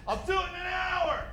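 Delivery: put-on high, quiet whiny teen brush-off; lazy teen reply. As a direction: mumble it like a bored teen. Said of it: "son replies lazily".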